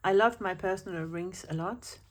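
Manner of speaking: ordinary talk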